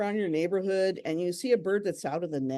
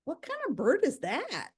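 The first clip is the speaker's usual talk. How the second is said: higher, wondering question; puzzled surprise; 9 semitones higher than usual. Retold or imitated. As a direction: sound curious and baffled. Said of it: "I was like".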